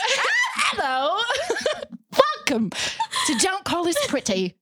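high, hammed-up English show intro; giggling through a terrible English accent; badly faked English host, breaking into laughs. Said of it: "It's so bad".

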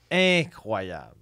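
drawn-out, hammy delivery of INCROYABLE; showy acting. Shown as a demonstration. Comedian boasting of his acting range, showy and drawn out. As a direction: stretch INCROYABLE out like a show-off actor. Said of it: "un registre de jeu".

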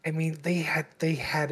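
hushed whisper. Real, not acted. Dramatic lament about a game.

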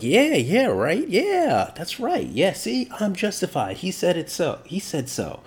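high-pitched, eager validation; hammed-up buddy agreeing with everything; agreeable best friend. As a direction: cheer them on like a yes-man friend, settling down at the end. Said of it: "best friend that is gonna be like".

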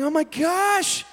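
high, drawn-out shock; freaking out. Retold or imitated. Driver who just hit a deer, freaking out. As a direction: stretch it out in alarm. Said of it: "So I'm freaking out".

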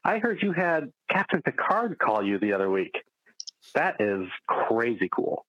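usual speaking voice

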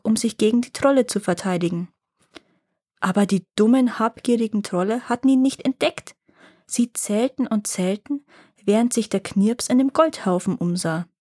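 ordinary delivery

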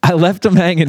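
high-pitched playful mock admission; joking. Answering the co-host's question about his story, joking.

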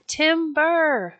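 high, drawn-out call falling away; roared call. A narrator's reading. Logger Pat warns as the redwood falls, roaring in storyteller's style. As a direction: call it out long, without full volume. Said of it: "Pat roared".

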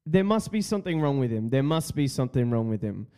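usual speaking voice

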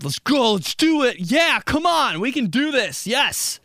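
high, loud pumped-up cheering; hyped gamer. Retold or imitated. Comic hyping his gaming partner, high loud cheers. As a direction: psych yourself up for the level. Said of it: "I'm like".

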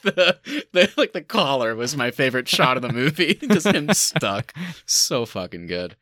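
normal talking voice

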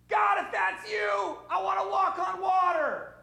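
very high, slow excited yell; hammed-up Peter yelling to Jesus; eager Peter shout. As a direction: shout it over the storm like a thrilled fisherman. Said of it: "I'm gonna help Sean here".

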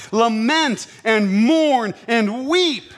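slow, drawn-out lament; solemn scripture. Acted. Preacher reading James to his congregation, slow and solemn. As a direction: stretch each word like a dirge. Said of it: "slow emphatic scripture reading".

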